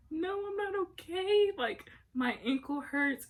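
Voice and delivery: high, imitated near-tears complaint; retold tearful protest, pitched high; mock whine. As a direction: whine it like a hurt crybaby. Retelling tearful answer to mom.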